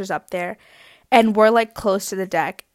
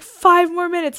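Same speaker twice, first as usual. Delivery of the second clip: high pleading whine; mock-pitiful plea; pained begging; 7 semitones higher than usual. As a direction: beg the bouncer for more time. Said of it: "that's how painful this was".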